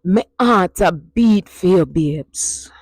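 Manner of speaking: Patois phrase "Me heart a beat for you" with dropped h, "heart" as "art"; Jamaican Patois. Shown as a demonstration. Patois teacher demonstrating a love line to the class. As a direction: say it like a Jamaican sweetheart. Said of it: "Now listen closely".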